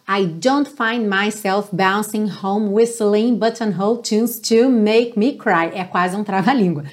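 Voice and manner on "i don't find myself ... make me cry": linked phrase spoken slowly; slow tongue twister. Shown as a demonstration. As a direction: practise slowly, linking words. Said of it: "as vozes cantam". (trava-língua devagar)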